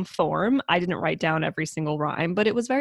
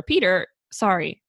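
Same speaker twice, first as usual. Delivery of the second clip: usual speaking voice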